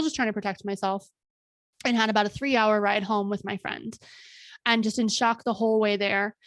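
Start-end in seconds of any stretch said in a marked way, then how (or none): none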